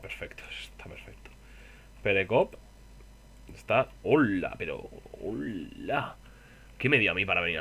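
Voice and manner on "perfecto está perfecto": susurro sin voz; susurrando. Real, not acted. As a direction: dilo en un susurro. Comenta el resultado en voz baja. (perfecto susurrado)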